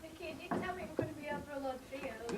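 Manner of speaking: hushed whisper. Acted. Linda to Mickey while bunking off school, whispering. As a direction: whisper it so nobody hears. Sneaking off across fields.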